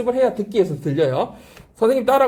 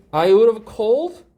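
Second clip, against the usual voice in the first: I would've called said very slowly, each word clear; slow drilled phrase; 3 semitones higher, 4 dB louder, much slower than usual. Shown as a demonstration. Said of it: "called야". (I would've called를 아주 천천히 또박또박)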